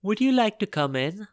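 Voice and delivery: polite, high princess voice; sweetly courteous fairy-tale princess; cartoon princess. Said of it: "asked the princess politely".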